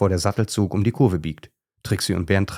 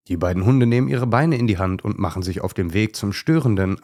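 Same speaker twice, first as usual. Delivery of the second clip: plain reading voice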